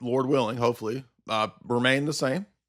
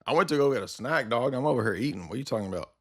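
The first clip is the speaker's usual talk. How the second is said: usual speaking voice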